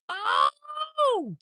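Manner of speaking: very high, drawn-out "oh"; high held cry, dropping at the end; high whoop. Real, not acted. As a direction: let out a long falsetto whoop. Whooping at his own joke.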